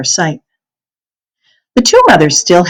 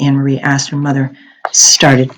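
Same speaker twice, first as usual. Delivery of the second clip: usual speaking voice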